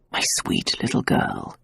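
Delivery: barely voiced and soft; a man whispering tenderly to his frightened lover. A narrator's reading. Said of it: "he whispered".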